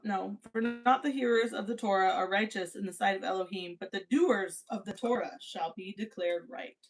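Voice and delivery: ordinary delivery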